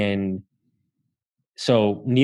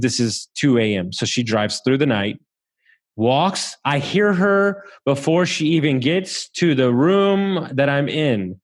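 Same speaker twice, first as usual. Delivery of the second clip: usual speaking voice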